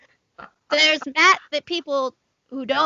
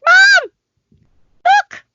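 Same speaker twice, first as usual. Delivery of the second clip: put-on high, loud child calling her mom; excited kid calling; 10 dB louder than usual. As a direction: call out to mom like an eager nine-year-old. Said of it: "she's like".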